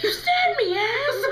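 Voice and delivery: high, loud pained accusation; hammed-up tearful fury; outraged wail. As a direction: yell it as if just hurt. Accusing the stitcher in pain.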